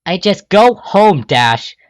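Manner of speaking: high loud slow yell. Acted. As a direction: yell to make her leave. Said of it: "you yell".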